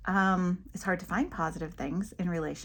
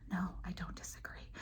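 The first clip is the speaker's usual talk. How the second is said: whispered, far softer; hushed denial; 14 dB quieter than usual. Pretend. Speaker answers someone assuming she disagrees, hushed. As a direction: deny it under your breath. Imagined reply to accusation of disagreeing.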